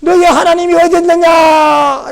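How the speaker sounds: very high, drawn-out taunting yell; mocking shout. Unbelievers taunting the psalmist, mocking shout. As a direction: jeer it out slowly at full shout. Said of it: "라고 비웃습니다".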